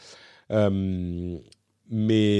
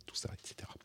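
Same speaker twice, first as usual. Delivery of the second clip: whispered; 22 dB quieter, faster than usual. Real, not acted. Trailing whispered aside.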